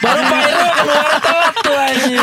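laughing loudly through the words; laughing while talking. Real, not acted. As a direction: talk through a big laugh. Laughs about the confetti.

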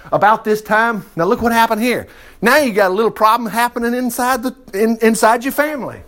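ordinary talk, nothing marked

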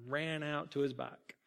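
plain reading voice

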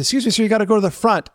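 brisk customer-service firmness; polite but firm skipper. Retold or imitated. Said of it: "I said".

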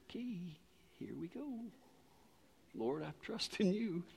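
quietly resign yourself to trusting; small, hushed voice imitating a nervous prayer; tentative inner prayer. Retold nervous thought, prayer while stranded.

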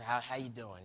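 put-on quiet, kindly greeting from an old friend; hushed greeting. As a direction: keep it down so the guards won't hear.

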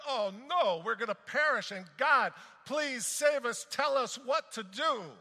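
panicked prayer. Retold or imitated. People in a burning house begging God in panic. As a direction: beg God to save us as the house burns.

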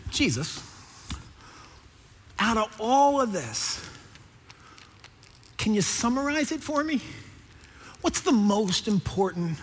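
hushed, careful asking; measured questioner. Acted. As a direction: pose it quietly with long pauses. Lawyer puts his question to Jesus.

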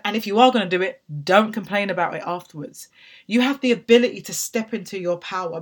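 usual speaking voice

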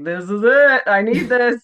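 eager relief. Retold or imitated. Retelling her eager signup.

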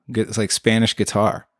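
ordinary talk

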